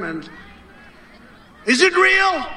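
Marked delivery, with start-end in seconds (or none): 1.7-2.5 mock interviewer question.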